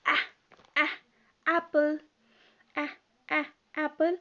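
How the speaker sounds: "A, a" said as a short phonic a sound before "apple". Shown as a demonstration. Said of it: "एप्पल में ज की sound".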